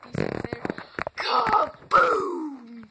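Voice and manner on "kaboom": shouted thunder boom. Retold or imitated. Imitating thunder crash.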